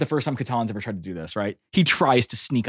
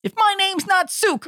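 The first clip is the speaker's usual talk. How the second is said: very high, slow squirrely voice; slower than usual. Acted. Player riffs on the game master's character, squirrely voice. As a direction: mimic the squirrely little character, drawn out.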